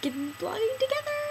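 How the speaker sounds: high eager call; excited idea. Acted. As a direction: pitch it up with eager delight. Excited idea, then echoed.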